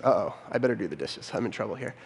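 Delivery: quiet mock realisation; sheepish uh-oh. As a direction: mutter it like you know you're caught. Husband realises he's in trouble.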